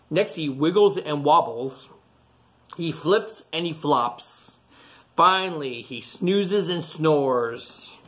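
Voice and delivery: ordinary delivery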